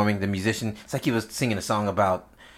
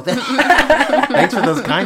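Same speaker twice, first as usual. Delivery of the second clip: real laughter, higher and louder through the words; laughing; 13 semitones higher, 9 dB louder, much slower than usual. Real, not acted. Guest laughs at friend's backhanded compliment, laughing. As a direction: laugh out the thanks.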